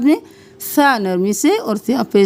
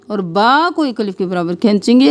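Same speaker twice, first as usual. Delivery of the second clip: normal talking voice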